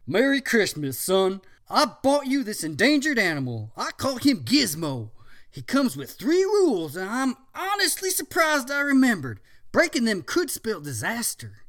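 jolly dad voice. Acted. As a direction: announce a gift heartily. Father voiced.

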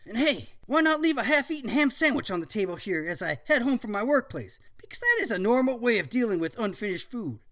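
teacher voice. Acted. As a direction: ask like a keen scientist.

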